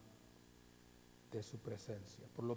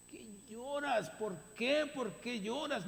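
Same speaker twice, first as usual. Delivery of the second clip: a firm, higher rebuke of a needless crier; scolding; 7 semitones higher, 4 dB louder than usual. Shown as a demonstration. Angels rebuking Mary for crying, firm scolding tone. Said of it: "Es un regaño".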